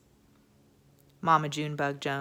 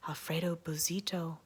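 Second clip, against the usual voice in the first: soft unvoiced whisper of the name; 7 dB quieter than usual. Crowd whispering the bandit's name in a hush. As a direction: breathe the name in fear. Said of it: "whispered the crowd".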